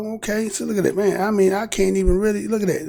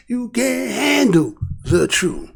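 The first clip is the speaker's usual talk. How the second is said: slow, softer movie-line delivery; a light nod to the courtroom scene; Jack Nicholson quote; 4 semitones higher, 4 dB quieter, slower than usual. Retold or imitated. Quoting Jack Nicholson to the viewers, slow and understated. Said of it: "Jack Nicholson said".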